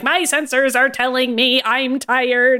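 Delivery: slow, deliberate robot readout; Herbie. Retold or imitated. Imitating the robot's catchphrase for every single thing, measured robot voice. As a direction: report it like a literal-minded robot. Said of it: "he starts with, "My sensors tell me".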